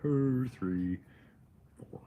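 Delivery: exaggerated dragging delivery; slow-motion parody of plodding logic; mockingly slow. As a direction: draw it out step by step, amused.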